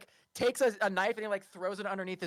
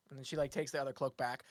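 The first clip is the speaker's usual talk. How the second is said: normal talking voice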